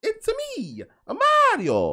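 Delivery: high, loud Mario impression; exaggerated Italian accent. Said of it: "supposed to add syllables where they don't belong".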